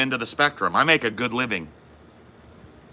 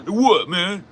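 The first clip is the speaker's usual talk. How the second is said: mock boasting friend; 7 semitones higher than usual. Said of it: "And I'm like".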